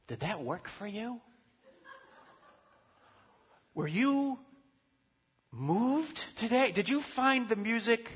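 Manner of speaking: mock a thrill-seeking churchgoer fishing for feelings; gushing experience seeker. Churchgoer asking in the car after service, high and gushing. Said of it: "the question you ask ... is something like".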